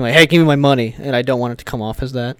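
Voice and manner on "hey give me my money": pushy demand. Retold or imitated. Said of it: "like, pushing".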